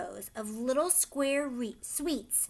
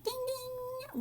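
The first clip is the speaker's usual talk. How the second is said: bell ding imitation; 4 dB quieter than usual.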